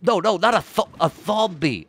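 high, sputtering mock Madrid accent; hammed-up Madrid accent, th for z; Madrid lisp. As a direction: protest in a lisped Madrid accent. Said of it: "you've got a Madrid lisp".

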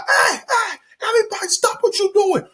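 high-pitched imitated holdup call. Robbers ordering a store to stop, high-pitched shout. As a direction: halt the room with a mock high cry.

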